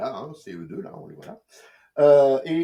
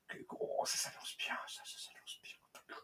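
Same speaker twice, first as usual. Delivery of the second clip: hushed whisper, fading to almost nothing; 18 dB quieter than usual. Acted. Reviewer confiding excitement to viewers in a whisper. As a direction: breathe it like a secret treat. Thrilled aside about the announcement.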